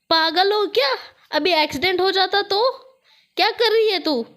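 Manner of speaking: loud, high scolding shout; angry yell. A narrator's reading. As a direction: snap at her after the sudden brake. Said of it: "चिल्लाते हुए बोलती है". (गुस्से में चिल्लाते हुए)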